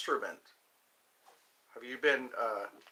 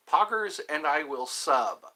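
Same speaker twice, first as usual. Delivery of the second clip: normal talking voice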